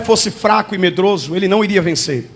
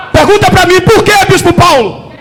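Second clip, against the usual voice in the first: calling out; 8 semitones higher, 4 dB louder than usual. Real, not acted. Preacher calls out to congregation.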